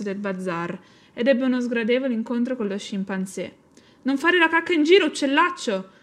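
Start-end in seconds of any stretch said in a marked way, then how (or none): 4.0-5.9 high, loud squawk of a scolding chimp; shrieked scolding. A narrator's reading. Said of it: "strillò Mattia". (strillo acuto e forte di rimprovero)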